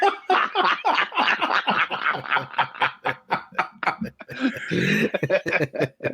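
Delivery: long helpless laughter, high in pitch; long laughing fit. Real, not acted. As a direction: crack up laughing at the recording. Cracking up after the clip.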